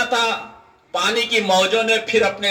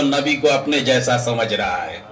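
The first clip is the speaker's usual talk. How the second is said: very low, sinking pitch; low, settled close of a thought; grave statement; 8 semitones lower than usual. Real, not acted. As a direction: drop the voice and let it land. Preacher lands the point before repeating.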